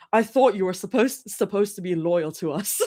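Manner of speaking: put-on clumsy British accent with a laugh creeping in; very bad British accent. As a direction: attempt a British accent, badly. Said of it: "Very bad British accent".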